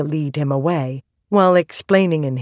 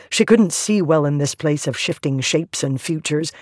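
ordinary delivery

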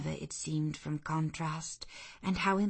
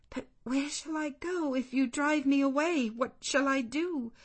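higher, pleading voice; desperate plea; 9 semitones higher than usual. Acted. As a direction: beg as if she has nowhere left.

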